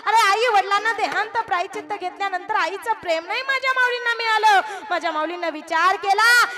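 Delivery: impassioned preaching. Acted. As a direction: pour feeling into the sermon. Emotional preaching peak. (भावपूर्ण जोशात कीर्तन)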